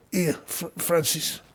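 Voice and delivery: soft, offhand stoner voice. Retold or imitated. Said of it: "he'd just go like".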